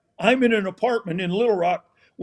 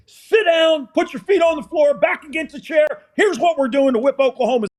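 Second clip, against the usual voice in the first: high, loud commanding orders; coach barking orders; 6 semitones higher, 4 dB louder than usual. Retold or imitated. Coach orders the team to sit up, loud commands. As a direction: drill the team to sit up straight.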